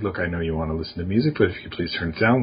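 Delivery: low, quick, soft-pedalled plea; toned-down, hurried reasonable request; reasonable parent. Pretend. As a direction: reason with the kid quietly and quickly. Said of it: "being reasonable in the sense that".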